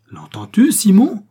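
high, loud cry of outrage; outraged outcry. A narrator's reading. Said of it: "outrée". (cri outré, aigu et fort)

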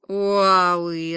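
long, drawn-out animal wail; eerie parrot howl. A narrator's reading. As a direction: howl "oo-ah-oo-ee" in one sustained swoop. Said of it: "раздался жуткий вопль".

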